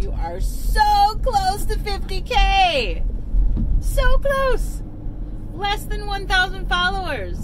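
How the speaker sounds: high, loud and slow with excitement; bursting with excitement over fifty K; thrilled. Real, not acted. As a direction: gush over the news, voice soaring. Mom excited about follower milestone.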